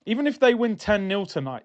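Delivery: ordinary talk, nothing marked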